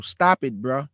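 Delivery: ordinary talk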